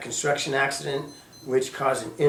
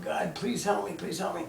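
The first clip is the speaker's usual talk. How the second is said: retold tearful plea; 4 semitones higher, 7 dB quieter than usual. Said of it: "I'm laying there crying and just going".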